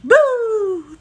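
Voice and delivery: loud playful ghost shout; jump-scare someone as a ghost; spooky boo. Pretend. Playing a ghost again.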